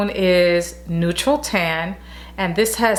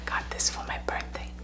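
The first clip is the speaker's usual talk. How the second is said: unvoiced hushed whisper; soft breathless whisper over a perfume; 14 dB quieter, faster than usual. Real, not acted. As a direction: whisper it like a secret. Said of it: "Why am I whispering?".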